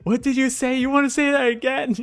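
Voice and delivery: high, loud mock threat; hammed-up high challenge; mock threatening. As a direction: pick a fight in a squeaky voice. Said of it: "It's like".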